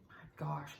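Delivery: soft breathy whisper under the breath; whispered aside. Real, not acted. Whispering to herself about needing coffee, drowsy. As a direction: whisper it to yourself, half amused.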